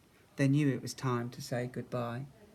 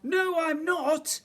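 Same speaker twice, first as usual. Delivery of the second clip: very high, slow, loud protest; crying out; 6 dB louder, slower than usual. A narrator's reading. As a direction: cry it out, stretching each word. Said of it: "cried the voice".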